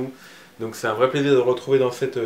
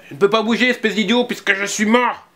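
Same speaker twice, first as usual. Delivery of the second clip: put-on high, louder exasperated boy; cross child; 9 semitones higher, 4 dB louder than usual. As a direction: snap at the idiot. Anton annoyed at Lucas.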